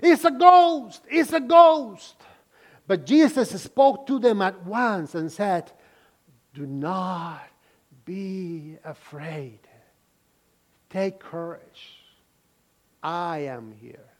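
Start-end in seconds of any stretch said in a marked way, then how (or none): 0.0-2.2 high, loud frightened cry, slowed; terrified cry. Acted. Disciples crying out at the figure on the water, terrified. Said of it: "they cried out". 6.5-14.1 soft, slow, lower comforting voice; calm them gently, almost hushed; reassuring. Acted. Jesus calming his terrified disciples, soft and tender.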